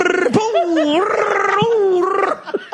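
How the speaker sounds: high brrr and boom effects; cartoon tumble sounds; tumbling noises. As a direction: sound out a body rolling and bouncing. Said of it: "He'd go".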